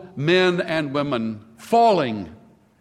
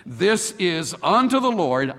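normal talking voice

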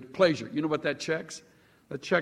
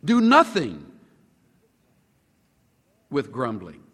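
ordinary talk

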